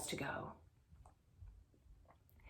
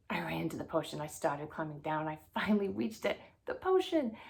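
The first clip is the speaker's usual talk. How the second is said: ordinary delivery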